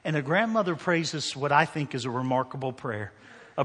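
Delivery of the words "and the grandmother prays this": ordinary talk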